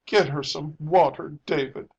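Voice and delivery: distressed mother pleading; sobbed. A narrator's reading. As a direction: beg him with a touch of a sob. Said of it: "sobbed her mother".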